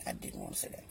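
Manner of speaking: a hushed confession to the viewer; whispered aside. Real, not acted. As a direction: whisper it under your breath. Aside about what she held back.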